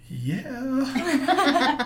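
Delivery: high, drawn-out, laughing yeah; thrilled yes to the magazine editor; excited laughing yes. Retold or imitated. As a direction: let the yes burst out with joy. Accepting award excitedly.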